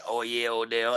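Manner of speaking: low, quiet villain purr; evil villain voice. Retold or imitated. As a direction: sneer it like a cartoon villain. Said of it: "now you want him".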